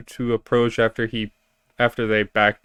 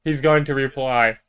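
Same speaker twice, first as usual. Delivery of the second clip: normal talking voice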